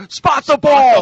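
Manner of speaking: high, loud furious yell; imitated screaming. Imitating an executive yelling at the officials, loud high scream. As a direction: holler it at the referees from the press box. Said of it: "he's screaming from there".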